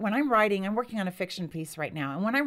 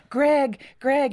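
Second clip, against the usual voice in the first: high, loud panicked calls of his name; screaming for husband; 7 semitones higher, 7 dB louder than usual. Retold or imitated. Pregnant wife screams for her husband during septic flood, loud and high. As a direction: yell his name in alarm as the tank bursts. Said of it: "And I'm screaming".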